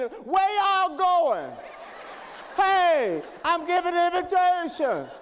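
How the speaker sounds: loud, exasperated shout at people walking out, half laughing; frustrated yelling. Retold or imitated. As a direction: yell after the folk leaving the altar call. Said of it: "frustrated to the point of getting".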